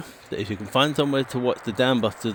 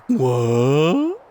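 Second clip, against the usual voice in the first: a puzzled high "What?" in a put-on voice; imitating a silly radio sound effect; mock sound effect voice; 4 semitones higher, 6 dB louder than usual. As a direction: ham up a baffled "What?" clip. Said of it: "What?" sound effect".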